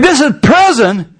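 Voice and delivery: ordinary talk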